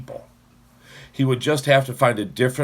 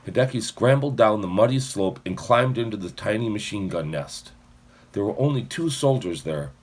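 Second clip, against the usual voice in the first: ordinary delivery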